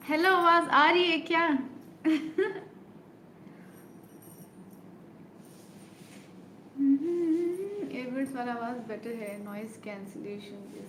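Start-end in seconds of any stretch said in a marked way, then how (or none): 0.1-1.6 much higher, loud call; calling out to check she's heard; shouting a sound check. Real, not acted. Asking viewers if they can hear her, loud. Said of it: "मत चिल्लाओ भगवान के लिए". 1.6-7.9 high breathy giggling fit; long giggle. Real, not acted. Laughing at a viewer's teasing, high giggles.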